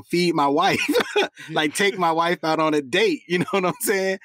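laughing while talking. Real, not acted. Laughing at own joke.

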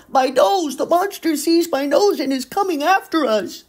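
frightened. Acted. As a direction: panic as the monster nears. Monster approaching, panic.

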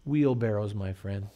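soft, low, knowing reply; sly quiet punchline. Retold or imitated. Worker quietly revealing the trick to the guard. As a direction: deliver the punchline dry and low.

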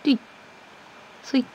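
ordinary talk, nothing marked

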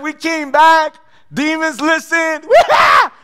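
excited disciples. Retold or imitated. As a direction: cheer like you just won big. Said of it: "They're excited".

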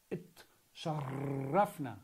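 slow repetition. Shown as a demonstration.

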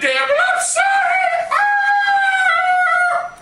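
high shouted singsong wail; wailing outburst. Acted. Scarlet apologising to Mister Butler, in a high wailing cry.

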